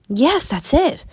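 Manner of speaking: high, pressing questions; demanding. A narrator's reading. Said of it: "demanded Tsutsa".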